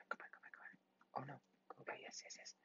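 whispered urging; tense whisper. Real, not acted. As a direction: whisper it under your breath, willing it on. Whispered urging during game.

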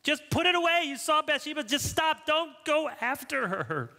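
high, urgent mock plea; exasperated warning. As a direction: cry out as if trying to stop David in the story. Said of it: "How many times you hear the David story".

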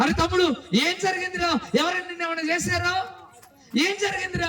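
high-pitched, urgent acted questioning; alarmed brother. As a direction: demand answers in mounting alarm. Alarmed brother questions bloodied younger brother. (కంగారుగా ప్రశ్నిస్తున్న అన్నలా)

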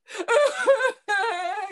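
loud high laugh. Retold or imitated. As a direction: burst out laughing loudly. Dad's reaction laughed.

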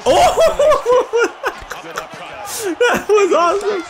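real, delighted high laughter; laughing hard. Real, not acted. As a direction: crack up and squeal the words through laughter. Laughing at a wild game moment.

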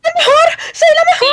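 high gloating cheer; triumphant shout. Real, not acted. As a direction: crow it like a champion. Boasting after winning.

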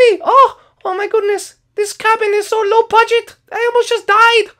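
very high squeaky voice, laughing in fright; Sing Song, shaken. Acted. Sing Song complaining about the crash, very high and rattled. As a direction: squeal it out after a scare.

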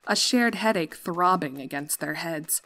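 plain reading voice

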